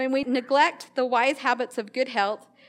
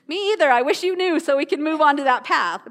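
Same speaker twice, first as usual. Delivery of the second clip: exasperated joke; 3 semitones higher, 6 dB louder than usual. Retold or imitated. Joking reply to wife Karina.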